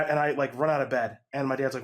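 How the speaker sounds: usual speaking voice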